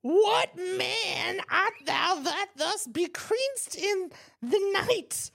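put-on high, loud old hag voice. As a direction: play Juliet as a cackling old crone. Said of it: "I just wanted to sound like an old hag".

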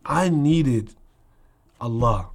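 normal talking voice

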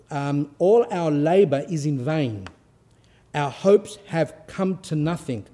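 loud, slow solemn reading; dramatic reading aloud. Acted. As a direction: read the holy text with gravity. Reads hagiography aloud to listeners.

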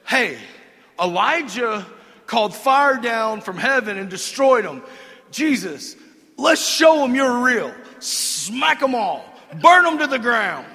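mock zealous cry for fire; eager vengeful disciples. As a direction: egg Jesus on like hotheads. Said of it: "And they said".

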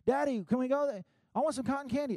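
put-on high, eager little-kid voice; asking child. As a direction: beg Daddy like a bold kid. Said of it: "A request without fear".

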